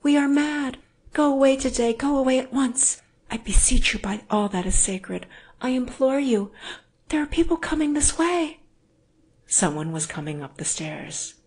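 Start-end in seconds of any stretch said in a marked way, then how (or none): 0.0-8.6 high, loud frantic woman; horrified pleading. A narrator's reading. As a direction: implore him urgently to leave. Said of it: "she cried in horror, pushing him away".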